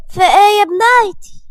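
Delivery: high, loud, wounded appeal; sad Nagia. A narrator's reading. Mother hurt as her daughter shrinks away, sad appeal. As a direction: plead sadly with the voice lifted. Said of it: "وهنا ناجية قالت بحزن".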